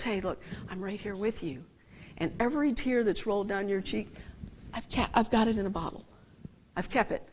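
whisper-close comfort to the grieving; tender reassurance. Acted. Voicing God comforting.

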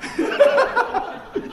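loud, high burst of laughter; big laugh. Real, not acted. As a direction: crack up at your own joke. Laughs at his own joke.

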